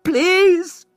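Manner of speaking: high, loud desperate shout. Acted. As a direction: yell it to stop her leaving. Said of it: "shouted Hessel".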